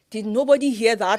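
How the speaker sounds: mock outrage at what was just heard; incredulous outburst. Acted disbelief at actors' mistake.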